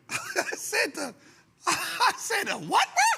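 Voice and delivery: laughing through the words, slow and high; slow laughing what; laughing disbelief. Real, not acted. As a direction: crack up asking what he said.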